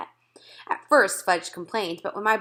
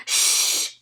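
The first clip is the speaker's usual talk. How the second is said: breathy shh hiss; shushing. A narrator's reading. Audience members shush the talking child. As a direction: hush the noisy kid. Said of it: "the people in front of us said".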